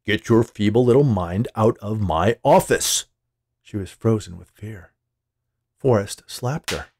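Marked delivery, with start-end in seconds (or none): 0.0-3.0 loud, slow, furious dismissal; acted towering rage, loud and drawn out; angry boss. A narrator's reading. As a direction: lean on every word as you throw her out. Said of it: "He stood towering over the shocked woman".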